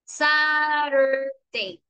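Saturday said very slowly, every sound stretched; slow model word. Shown as a demonstration. Teacher models Saturday slowly to quiz the class. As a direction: spell out Saturday aloud by sound, unhurried. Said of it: "¿la U es corta o es larga?".

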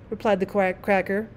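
ordinary delivery

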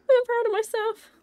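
high, happy self-praise said aloud; voiced pride. Real, not acted. Repeats self-praise out loud.

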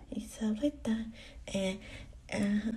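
whispering. Acted. Trailing off unvoiced.

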